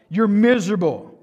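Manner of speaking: normal talking voice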